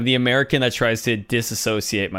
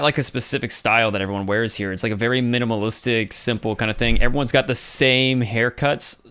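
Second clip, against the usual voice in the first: normal talking voice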